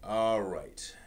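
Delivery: ordinary delivery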